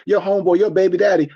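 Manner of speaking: ordinary delivery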